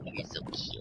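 put-on airy whispered coo at a baby pigman; baby talk. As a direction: coo softly at something adorable.

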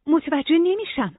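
high, slow, baffled complaint; frustrated, pitched well up; exasperated. A narrator's reading. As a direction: say it at a loss and fed up. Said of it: "نورا کلافه نگاه کرد و گفت".

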